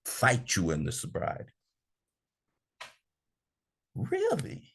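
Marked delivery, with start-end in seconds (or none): 3.9-4.7 squeaky falsetto "Really?"; mock disbelief. Speaker mocks his own excuse, high incredulous squeak.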